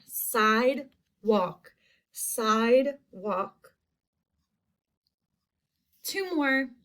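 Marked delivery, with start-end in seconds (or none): 0.1-3.7 sidewalk slowly split into side and walk; syllable by syllable. Shown as a demonstration. Teacher points out the long I, sidewalk in two slow beats. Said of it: "I hear that long I".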